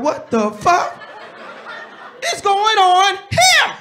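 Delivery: shocked outburst. Retold or imitated. Husband shocked at flirting.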